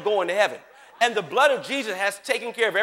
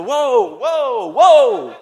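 louder and louder startled whoa with a laugh; mock shocked whoa; 4 semitones higher, 14 dB louder, much slower than usual. Newlywed husband at the beach, comically startled. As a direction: react like the sight hits you.

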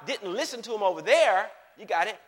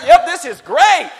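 forced cheer; 8 semitones higher, 16 dB louder, slower than usual. Retold or imitated. Husband forcing cheer.

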